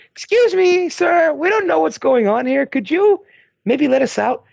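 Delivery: high, meek pleading voice; trapped French teacher asking to be let out, mock meek and high; timid teacher voice. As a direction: ask politely like a frightened teacher.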